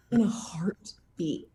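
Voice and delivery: breathy slow whisper, dead serious. Real, not acted. Whispering to the interviewer how fast she'd say yes. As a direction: lean in and whisper it slowly.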